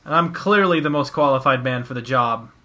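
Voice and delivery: ordinary talk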